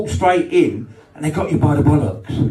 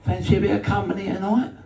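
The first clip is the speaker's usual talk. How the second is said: high, quicker, hushed come-on; sultry woman; 8 semitones higher, 5 dB quieter, faster than usual. Retold or imitated. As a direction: tempt him softly like a flirt. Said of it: "She said".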